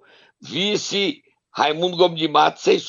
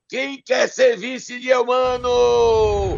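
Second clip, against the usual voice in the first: auctioneer call; 5 semitones higher, much slower than usual. Pretend. Auctioning off the vice slot.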